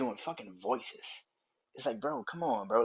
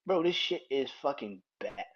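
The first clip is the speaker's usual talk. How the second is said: ordinary talk, nothing marked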